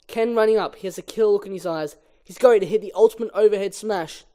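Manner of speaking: normal talking voice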